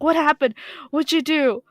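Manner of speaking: mock parental yell, urgent questions; dad yelling. Retelling dad woken by a scream, imitated yelling. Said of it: "yelling at me".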